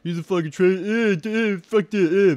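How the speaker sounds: high, loud sputtering mock outrage; mocking critic. Mocking online critics building a straw man, high sputtering outrage. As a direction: parody a sputtering critic losing it. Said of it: "create a fucking straw man on what I'm saying".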